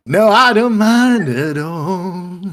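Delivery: slow, high, loud, drawn-out; a big cheerful machine talking slowly; jukebox voice. Acted.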